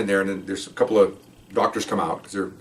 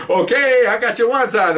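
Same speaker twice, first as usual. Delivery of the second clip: high, louder teasing send-off; jovial parting; 12 semitones higher, 4 dB louder than usual. Retold or imitated. Doctor teasing the recruit who won't shake, jovial. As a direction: play a joking farewell, pitched up. Doctor jokes as they part.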